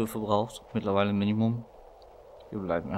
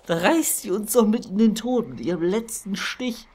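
high, loud exasperation; outraged; 11 semitones higher, 5 dB louder than usual. Real, not acted. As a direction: rant at the unfair death. Outraged at spider's last sting.

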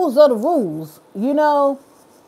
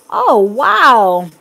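taken aback; 2 semitones lower, 8 dB louder than usual. Real, not acted. Reacting to the comment.

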